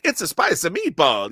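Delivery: loud, high cartoon Italian accent; hammy Italian accent. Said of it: "I don't want it to be like".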